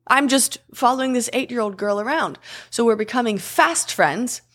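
usual speaking voice

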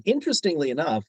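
ordinary delivery

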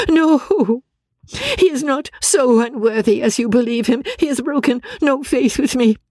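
very high, tormented wailing voice; distraught Marianne. Acted.

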